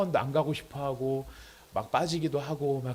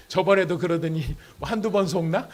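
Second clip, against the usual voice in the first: louder, sceptical scoff; acted sneer; 4 semitones higher, 6 dB louder than usual. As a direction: sneer that you won't be fooled. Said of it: "이런 비웃음을 가질 수 있다는". (못 믿겠다는 듯 비웃는 흉내)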